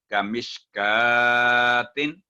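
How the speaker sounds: का said with a thick, heavy क; heavy letter recitation. Shown as a demonstration. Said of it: "thick letter".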